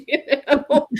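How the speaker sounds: usual speaking voice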